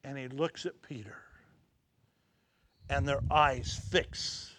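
soft, low, slowed storytelling; hushed preaching. Acted. Preacher softly describing Jesus looking at Peter, hushed. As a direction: draw them in with a hushed voice.

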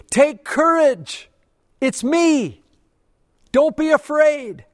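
high, loud, slow call across the storm; Jesus calling out. Acted. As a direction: shout it out over the wind to scared men. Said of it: "and He yells".

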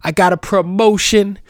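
high, loud, excited mock fan; grateful fan. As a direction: gush like a changed reader. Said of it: "they're gonna be like".

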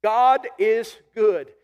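slow, weighty God is good; drawn-out proclamation God is good; slow emphatic declaration. Shown as a demonstration. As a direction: lean on every word. Preacher repeats key phrase.

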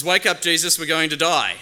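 high, loud terrified cry; panicked shout. Acted. Disciples shout for the sleeping Jesus, panicked and loud. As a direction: yell to wake him before the boat sinks.

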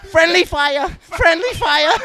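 high alarmed callout; alarmed shout. Pretend. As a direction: yell a warning to the team. Mock game callout.

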